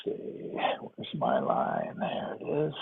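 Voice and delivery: quiet muttering. Real, not acted. Muttering to himself off mic.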